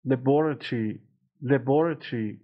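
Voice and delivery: British "Laboratory", luh-BOR-uh-tree; British "Laboratory" ending in -tree; British pronunciation. Shown as a demonstration. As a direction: stress the second syllable of "Laboratory", British style. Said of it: "تو تلفظ بریتانیایی".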